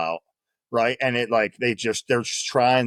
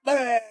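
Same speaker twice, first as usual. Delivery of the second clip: mock bleh; 14 semitones higher than usual. Drill instructors losing it.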